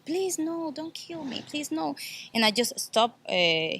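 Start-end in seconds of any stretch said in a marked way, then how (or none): 0.1-2.0 high, soft mock yell of pleading; retold yelling. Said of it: "She was yelling".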